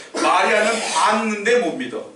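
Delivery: ordinary talk, nothing marked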